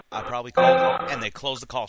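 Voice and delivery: loud frustrated rant; exasperated outburst. Real, not acted. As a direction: vent it loudly. Ranting after the call dropped.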